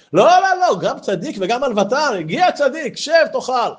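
voicing an eager host; insistent host. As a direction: wave off his modesty eagerly. Host insists the tzaddik eat.

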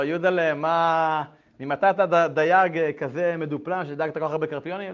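a mother demanding an explanation; mother's loud question. Retold or imitated. Mother questioning her son about the carp, loud and surprised.

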